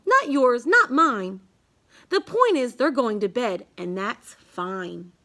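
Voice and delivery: ordinary delivery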